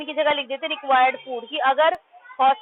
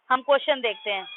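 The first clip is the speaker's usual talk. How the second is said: normal talking voice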